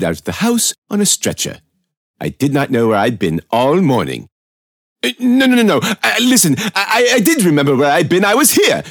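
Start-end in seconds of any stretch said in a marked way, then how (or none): 5.0-8.8 high, loud and rushing, losing his composure; flustered panic. Real, not acted. Cornered by the detective, he babbles in high loud panic. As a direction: babble in rising panic as the questions pile up. Narrator flustered under questioning.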